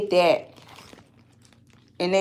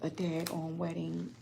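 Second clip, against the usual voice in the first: much softer, close to a murmur; despairing, quiet; 4 semitones lower, 13 dB quieter than usual. Real, not acted. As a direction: speak low and deflated, almost to yourself. Despairing over the missing dress.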